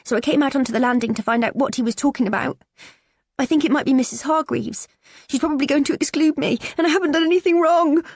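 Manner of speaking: put-on high, strained teen voice on the verge of tears; a bullied girl pleading to be believed; tearful teenage girl. Daughter confiding bullying to mum, tearful and high.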